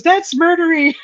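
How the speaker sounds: high, teasing friend voice. Retold or imitated. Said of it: "She goes".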